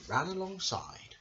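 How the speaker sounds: ordinary delivery, nothing marked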